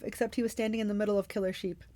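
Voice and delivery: plain reading voice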